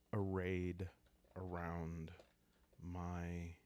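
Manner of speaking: usual speaking voice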